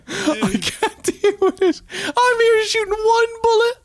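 high, laughing near-sob complaint; laughing whine. Real, not acted. Gamer complains to his friends, laughing whine. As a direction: whine through tears of laughter.

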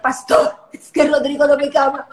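wife complaining to her pastor about her husband. Retold or imitated. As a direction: plead like a hurt young wife. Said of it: "le decía".

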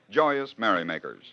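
ordinary talk, nothing marked

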